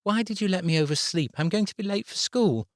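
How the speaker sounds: put-on high child's voice, worried; a little girl fretting about school; anxious young girl. A narrator's reading. Abby voiced by narrator.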